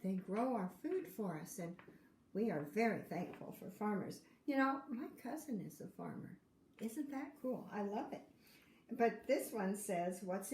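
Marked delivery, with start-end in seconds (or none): none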